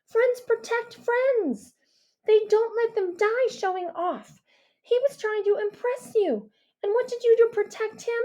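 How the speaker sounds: Mia's reproachful voice; girl's voice. A narrator's reading. Mia voiced by narrator.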